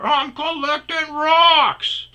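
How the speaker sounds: very slow, high and loud mimicry; mocking his excuse. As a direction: throw his lie back at him.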